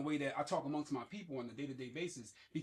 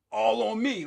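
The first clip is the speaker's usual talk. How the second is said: bold shout; 6 semitones higher, 10 dB louder, slower than usual. Retold or imitated. Acted bold bettor quote.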